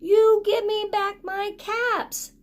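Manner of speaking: a cross demand, dragged out; angry peddler. Acted. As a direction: peddler angrily demanding his caps back from the monkeys. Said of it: "This made the peddler angry".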